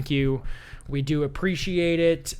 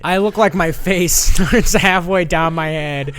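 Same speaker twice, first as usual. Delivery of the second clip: laughing while repeating the insult, louder; 6 dB louder than usual. Real, not acted. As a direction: crack up mid-sentence, loud and amused. Laughs at insult about himself.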